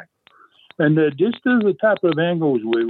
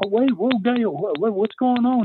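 mock-alarmed, pitched up high; bewildered reply; 8 semitones higher than usual. Friend on the phone asking what is happening, bewildered. Retold reply to panicked caller.

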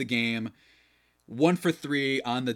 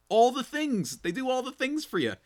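high-pitched, showy sales enthusiasm; gushing, much higher voice; playful hype; 14 semitones higher than usual. Real, not acted. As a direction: squeal it up for the sponsor. Playful hype in sponsor read.

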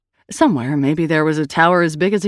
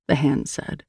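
usual speaking voice

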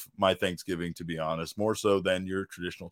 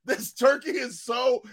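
rave shout; 12 semitones higher than usual. Retold or imitated. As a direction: rave like it's the best meal ever. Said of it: "I was just like".

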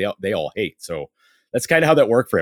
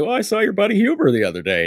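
much higher, playful innocent tone; innocent explaining; 13 semitones higher, 4 dB louder, faster than usual. Retold or imitated. Explaining to Rob with playful innocence. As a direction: play innocent about where it came from. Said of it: "I was like".

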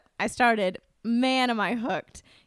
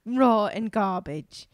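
kindly mock British judge; gentle British judge; 4 dB louder than usual. British judge consoling Nancy after criticism, mock-kind. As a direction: soften the blow in a British accent. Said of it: "beautiful, like, group is like".

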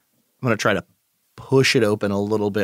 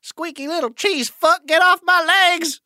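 panicked yelling; 3 dB louder than usual. Acted. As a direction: shriek at the rats to get away. Dwarf fighting off swarming rats.

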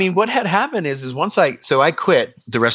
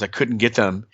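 usual speaking voice

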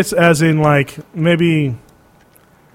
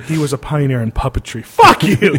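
normal talking voice

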